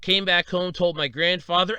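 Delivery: ordinary talk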